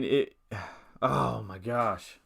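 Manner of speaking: slow, sighing oh my gosh; exasperated sigh. Real, not acted. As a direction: sigh it out, overwhelmed. Overwhelmed by transfer choices.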